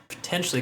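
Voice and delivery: potentially as the most prominent word in the line; potentially given weight as the key word; stress on potentially. Shown as a demonstration. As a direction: lean into potentially. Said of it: "I wanna emphasize the word potential".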